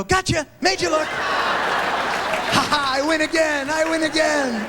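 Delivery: high gleeful shouted taunt; taunting gloat. Pretend. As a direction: crow it like a kid who won. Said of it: "I'm gonna turn around and go".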